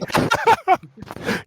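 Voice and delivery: squealing high laugh. Real, not acted. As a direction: burst into a high-pitched laugh. Laugh after story beat.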